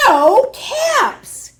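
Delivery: plain reading voice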